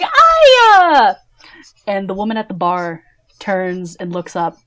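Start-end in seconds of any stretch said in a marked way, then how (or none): 0.0-1.1 high, loud, drawn-out greeting holler; loud, excited hail to a friend; calling out. Acted. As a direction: sing out the name across a busy bar. [name] calls out greeting to Aya.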